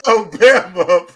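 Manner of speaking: slow words broken by real laughter; laughing. Real, not acted. Host cracking up at the guest's name, laughing.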